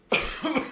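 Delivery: sudden choking cough; coughing after swallowing wrong; swallowed wrong way. Real, not acted. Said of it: "We went down the wrong hole".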